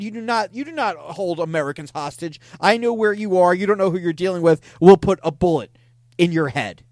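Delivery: hammed-up outrage, punched out loud; upset threat. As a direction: act out a loud, stern threat to a hostage-taker. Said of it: "got very, very upset by this".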